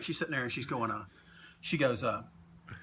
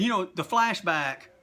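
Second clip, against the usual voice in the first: normal talking voice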